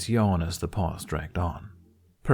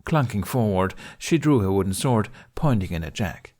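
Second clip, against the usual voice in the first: ordinary delivery, nothing marked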